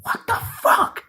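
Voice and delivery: high, breathy whispered shock; stunned disbelief. Retold or imitated. Kylo staring at the empty spot, a high whispered gasp of shock. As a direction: gasp it out, dumbfounded. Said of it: "Kylo's just like".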